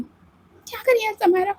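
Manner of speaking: high startled question; alarmed squeak. Acted. Aditi alarmed as Samaira opens the door, startled. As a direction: caught off guard.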